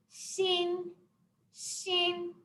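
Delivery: "xin" held high and even; level high tone. Shown as a demonstration. Teacher drilling the word for new, high level tone. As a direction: keep "xin" steady on a high note. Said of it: "go like this".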